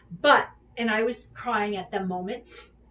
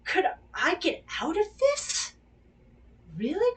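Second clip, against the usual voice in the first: higher and louder, startled inner voice; sudden realisation; 7 semitones higher, 6 dB louder than usual. Retold or imitated. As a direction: blurt it out as the penny drops.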